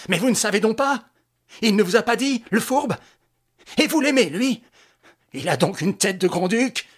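high, loud jealous outburst; cried out. A narrator's reading. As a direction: Lupin yelling at the rival, furious. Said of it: "il cria". (crie fort, furieux)

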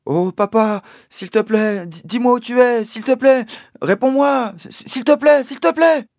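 high, loud, stammering plea; boy begging his missing father to answer, rising pleading cries. Acted. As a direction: beg him to answer, voice trembling. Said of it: "J'ai commencé à dire des choses idiotes".

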